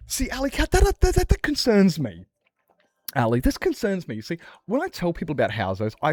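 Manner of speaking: mock concerned voice. Talking to Ali, hammed-up high-pitched concern. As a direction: squeak out the worry like a fussy busybody.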